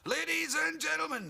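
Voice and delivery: high, loud stadium announcer voice; announcer intro. Retold or imitated. Stadium announcer introducing the act, loud and high. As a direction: announce it grandly to the crowd. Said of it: "this Mexican guy would come on".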